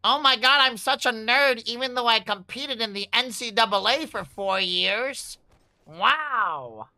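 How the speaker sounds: loud, high fake indignation; mock outrage. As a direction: act outraged and offended. Mock outrage at being called nerd.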